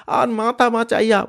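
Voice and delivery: high loud failing plea; broken cry; faint desperate cry. Acted. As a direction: gasp it as you pass out. Father fainting.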